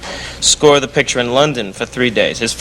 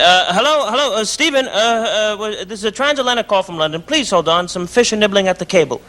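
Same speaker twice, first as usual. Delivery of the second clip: higher, hesitant put-on operator voice; fake operator voice; 7 semitones higher than usual. Prank caller stringing his friend along as an operator, higher put-on voice. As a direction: play the bumbling overseas operator. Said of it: "So I said".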